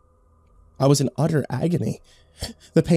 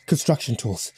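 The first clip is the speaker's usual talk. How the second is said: ordinary delivery, nothing marked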